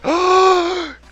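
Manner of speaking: sharp high gasp of awe; high dramatic gasp. Acted. Dramatic entrance of Gilgamesh.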